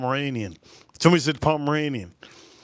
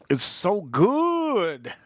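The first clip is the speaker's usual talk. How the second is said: very drawn-out, higher-pitched relish; overwhelmed delight; 6 semitones higher, much slower than usual. Real, not acted. Gushing to viewers over the whiskey, blissful. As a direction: stretch it out in whiskey rapture.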